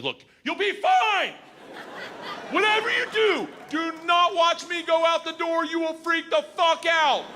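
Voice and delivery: yelling at a nervous jumper over the engine roar. Retold or imitated. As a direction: shout it over roaring engines. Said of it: "she starts yelling at me".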